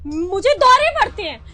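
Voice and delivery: loud outraged yell rising; angry shouting. Acted. Wife yelling at husband who calls her foolish, angry. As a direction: build the fury to a shout. Wife furious at being called crazy.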